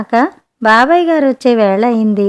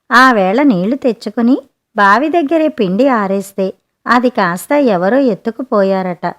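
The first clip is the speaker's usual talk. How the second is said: ordinary delivery, nothing marked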